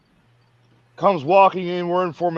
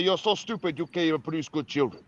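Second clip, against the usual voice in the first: put-on Ugandan accent; 9 dB quieter than usual. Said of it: "'cause he had a Ugandan accent".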